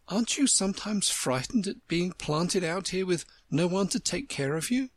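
put-on wistful little girl; young girl voice. Alice voiced.

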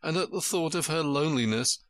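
ordinary delivery, nothing marked

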